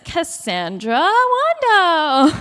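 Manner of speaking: drawn-out, rising announcer's call; big winner announcement. Real, not acted. Host announcing the giveaway winner, drawn out and big. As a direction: stretch the name out for the crowd.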